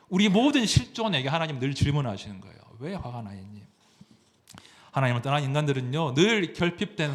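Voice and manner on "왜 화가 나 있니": low, hushed question; gentle questioning. Acted. God asking each person why, softly. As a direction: ask it softly, well under the preaching voice.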